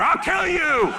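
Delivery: high laughing threat; fake-menace with a laugh; mock threat. Cub glaring at keeper after the swim, mock threat. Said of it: "it looked at me like".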